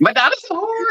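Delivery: very high squeaky take; mock shrill mother. Co-host echoing the movie mother in falsetto.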